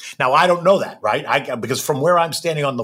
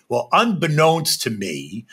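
ordinary delivery

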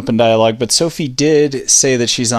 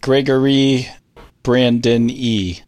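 very slow, separated syllables on "Brandon E"; counting out syllables; much slower than usual. Shown as a demonstration. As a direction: spell out "Gregory Brandon E" syllable by syllable. Counting syllables in his names.

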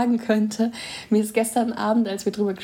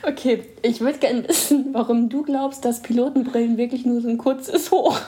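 stifled giggles; 3 semitones higher than usual. Real, not acted. Third try, still giggling.

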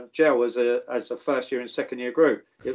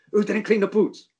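mock manager; 6 semitones higher than usual. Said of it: "He goes".

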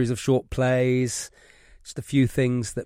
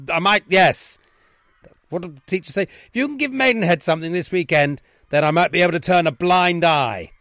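loud, raised, authoritative voice; stern headmaster; 5 semitones higher, 7 dB louder than usual. Acted. Headmaster dismissing boys with a hinted bargain, stern. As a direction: lay down the law, then hint at a deal. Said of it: "One is the Wrexham Headmaster's Office".

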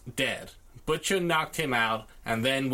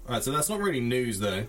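ordinary talk, nothing marked

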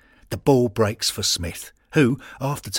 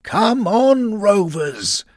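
high, loud rallying cry; full-lunged shout; 13 semitones higher, 8 dB louder, much slower than usual. A narrator's reading. Old Giancarlo roaring for his team from the stands, loud and high. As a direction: bellow it across the pitch. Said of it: "at the top of his ragged lungs shouts".

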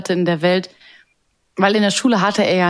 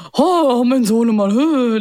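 proud father voice; 5 semitones higher, 3 dB louder, slower than usual. Retold or imitated. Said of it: "Und der Vater ist immer so".

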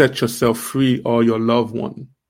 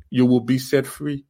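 ordinary talk, nothing marked

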